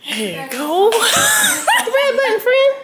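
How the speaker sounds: high, loud, laughing cheer; whooping, laughing holler; excited teasing shout. Real, not acted. Cheering as the button is found.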